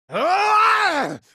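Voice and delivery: loud, high attack yell; battle cry. Acted. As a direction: let out a fierce, high fighting yell. Game fighter battle cry.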